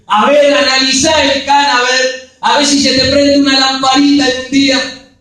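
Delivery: loud teasing rebuke; mock scolding. Preacher teasing clueless husband Elcana, loud mock scolding.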